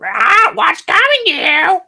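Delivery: squawky high parrot echo. Retold or imitated. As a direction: screech it back like a parrot. Parrot echoes mockingly.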